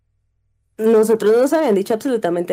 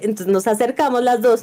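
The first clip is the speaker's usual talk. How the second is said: ordinary delivery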